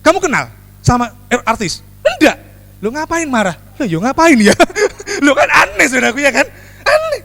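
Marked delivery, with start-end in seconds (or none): 2.0-2.4 high, sharp mock no; squeaky denial. Mock denial in the gossip scene. 5.2-7.2 high, laughing "aneh"; laughing at oddness. Real, not acted. Laughing over how odd it is.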